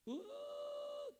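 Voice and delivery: high, drawn-out mock wail; hammed-up child's sob; imitated crying. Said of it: "dia nangis".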